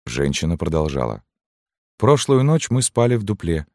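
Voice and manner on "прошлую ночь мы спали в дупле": high woman's voice; widow recounting. A narrator's reading. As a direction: tell it in a raised, plaintive voice. Widow continues her account. (голос вдовы, высокий)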